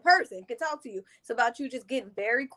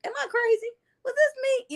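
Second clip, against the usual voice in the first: doubting inner voice; 13 semitones higher than usual. Retold or imitated.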